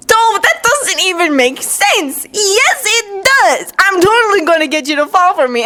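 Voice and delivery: put-on high-pitched childish squabble; high, stubborn back-and-forth; heated bickering. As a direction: argue back insistently, pitch way up. Two boys bicker back and forth.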